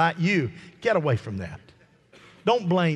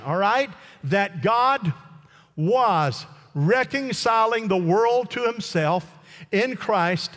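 raised, fervent preacher voice; preaching; 8 semitones higher than usual. Acted. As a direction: preach it with full force. Preaching at full force.

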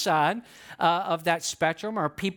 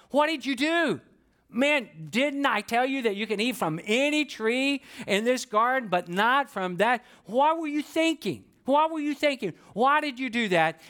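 raised, exasperated scolding voice of God; God scolding Adam; 4 semitones higher than usual. Retold or imitated. God confronting Adam over the forbidden tree, high exasperated scolding. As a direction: demand answers like a disappointed father.

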